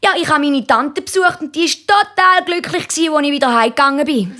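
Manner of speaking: hohe, eifrige Kinderstimme; Bubenstimme. A narrator's reading. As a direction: berichte hoch wie ein stolzer Schüler. Markus erzählt dem Lehrer stolz. (hochi, ifrigi Buebestimm)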